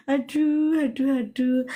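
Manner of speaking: aduh cried out high, then easing down; a startled, half-joking oh dear; mock dismay. Real, not acted. Host hears about the caller's fight, dismayed.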